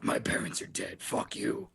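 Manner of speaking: unpitched, rough Batman rasp. Acted. Son snapping at parents over dinner, Batman growl. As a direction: growl it through like a brooding Batman.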